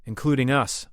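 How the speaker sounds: loud, firm confirmation; agreeing. A narrator's reading. Translator accepting the queen's point about the blast, agreeing. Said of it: "Marcus agreed".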